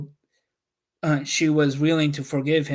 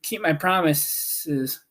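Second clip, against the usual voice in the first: usual speaking voice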